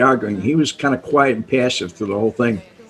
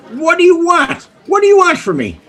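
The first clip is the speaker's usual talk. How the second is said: much higher, louder mock exasperation; imitating exasperated Sam; 4 dB louder than usual. Sam snapping at Kenny in the basement, hammed-up exasperation. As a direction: throw the question back like an annoyed boss.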